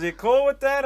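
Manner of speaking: high, loud prodding question; hammed-up, loud cocky question; mock show-off owner. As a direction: imitate a big spender egging someone on. Acting out owner checking, showing off.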